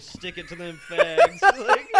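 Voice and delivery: mock line dissolving into loud high laughter; cracking up at own impression; laughing. Real, not acted. Mocking a bigot to friends, laughing.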